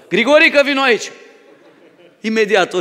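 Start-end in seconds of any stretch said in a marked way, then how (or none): 0.1-1.1 higher, louder shepherd's call; calling by name. Retold or imitated. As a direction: shout the ram over to you. Shepherd calls the ram.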